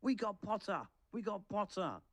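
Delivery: high-pitched triumphant chant; excited celebrating cry, not full volume; twins' yell. A narrator's reading. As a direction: cheer it out high, twice over. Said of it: "the Weasley twins yelled".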